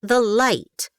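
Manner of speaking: high, cold disdainful order. Acted. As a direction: dismiss him with contempt. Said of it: "Edna's simple words held disdain".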